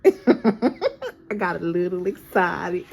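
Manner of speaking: slow, loud country drawl with laughter; amused imitation of a country accent. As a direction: playfully stretch it out in a country accent. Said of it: "Somebody got a country accent".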